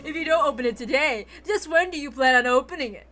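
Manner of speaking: ordinary delivery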